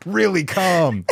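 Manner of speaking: high, loud insistence on calm; unconvincing composure, pushed high; revved-up voice claiming to be calm; mock calm. Jokingly insists calm while revved up.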